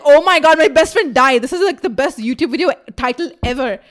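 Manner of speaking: over-excited retold outburst; mock alarm. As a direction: exclaim loudly in exaggerated shock. Retold reaction to friend fainting.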